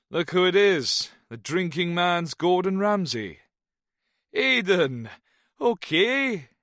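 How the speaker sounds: high, loud cheery Welsh voice; a jovial Welshman greeting an old friend; Welsh accent. A narrator's reading. Jones greets his old friend Snow in a loud Welsh accent. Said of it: "The Welshman's accent".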